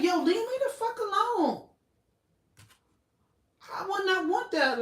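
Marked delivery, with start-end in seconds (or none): 0.0-1.7 higher, hammed-up leave-me-alone; mock angry retort. She tells the crowd following her to back off, mock angry. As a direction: snap it back at them in mock irritation.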